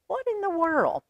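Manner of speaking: high, puzzled exclamation; puzzled wonder. Shown as a demonstration. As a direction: sound baffled by the title.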